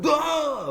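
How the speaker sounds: sudden high-pitched anime shout; anime rage yell. Retold or imitated. Teacher imitating an angry anime character for students, high yell. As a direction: snap into a cartoonish angry yell. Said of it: "when they're mad, they go".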